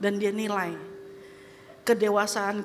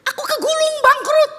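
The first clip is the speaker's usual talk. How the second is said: high, loud whining protest; whiny complainer; 11 dB louder than usual. Acted. Believer complaining to God about a breakup, whining loud and high. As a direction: moan it like the world ended.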